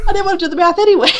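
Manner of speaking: high, fast, breezy; chirpy shrug; quick mock relief. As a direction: rattle it off gleefully.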